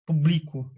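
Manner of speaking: "Publico" said as pu-BLI-co; stress on middle syllable. Shown as a demonstration. Teacher shows what not to say, stress on BLI of "Publico". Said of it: "nem publicó".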